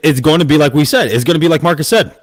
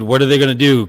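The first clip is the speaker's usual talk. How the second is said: ordinary talk, nothing marked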